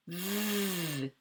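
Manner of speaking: "V" as a long buzzing consonant sound; sustained "V", just the sound; isolated sound. Shown as a demonstration. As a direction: hold the V sound softly, without naming the letter. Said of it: "La lettre V".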